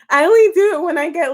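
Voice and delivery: giggly, hurried explanation; laughing. Real, not acted. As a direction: rush it out while laughing. Laughing while explaining voices.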